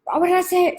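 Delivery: high, fed-up nag; exasperated mum. Retold or imitated. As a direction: pitch it up in frustration. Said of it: "I'm one of those mums".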